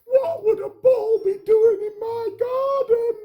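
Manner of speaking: high, loud panicky squeak; frantic squeaking fret; panicky worrywart. Acted. Worrywart sheltering behind the carrot, panicking. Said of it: "he panted".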